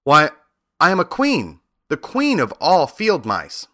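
high, indignant mouse queen; affronted little royal, voice lifted. A narrator's reading. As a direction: proudly declare your rank, pitched high. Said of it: "cried the little animal indignantly".

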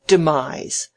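loud, scornful "demise", falling; mocking echo. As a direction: exclaim "demise" as a ridiculous fancy word. Mocking echo of letter word.

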